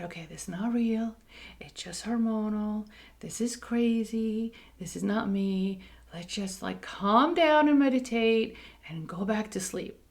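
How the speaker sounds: hushed whisper, talking herself down; soft whisper in the dark at night; whispered self-talk. Retold or imitated. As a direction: whisper to yourself to calm down.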